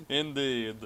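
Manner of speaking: ordinary delivery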